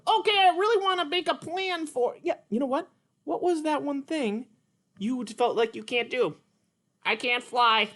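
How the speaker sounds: high, bright puppet character, eager; Petrie puppet voice. Acted. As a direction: play the little pterodactyl, keen to try.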